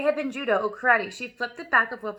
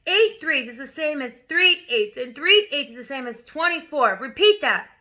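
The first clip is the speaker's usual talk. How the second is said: angry drilling; 2 semitones higher, 7 dB louder than usual. A narrator's reading. Headmistress drilling the dangling boy, loud and forceful. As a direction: hammer the fact in.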